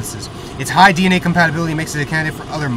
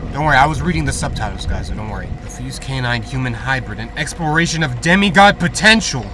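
ordinary delivery

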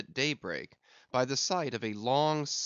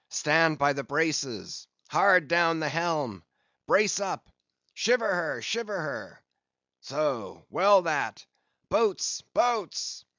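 raised, carrying ship's orders; shouted commands; 4 semitones higher, 5 dB louder, slower than usual. A narrator's reading. Captain ordering crew to braces and boats, shouting. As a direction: fire off orders, climbing high on the final call.

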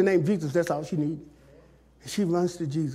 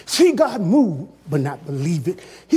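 ordinary delivery

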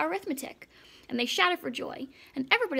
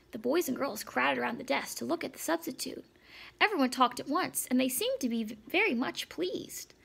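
plain reading voice

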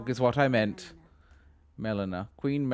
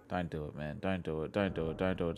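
low, soft pleading under his breath; hushed pleading; 6 semitones lower, 10 dB quieter, faster than usual. Real, not acted. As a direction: beg it softly not to notice you. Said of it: "pleading quietly with a bat".

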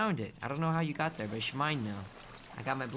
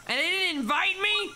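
mock outrage; 7 semitones higher than usual. Player uninvited to enemies' party, mock-offended outrage. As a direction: act hugely offended at being left out.

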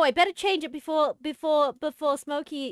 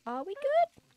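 anxious high question; 13 semitones higher than usual. Real, not acted. Checking if computer recovered.

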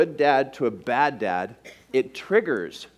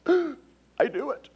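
dramatic wife; 11 semitones higher, 3 dB quieter, much slower than usual. Retold or imitated. Wife gasps over the food.

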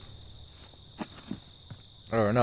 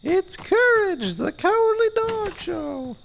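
high cartoon announcer. Imitating the show's intro announcer.

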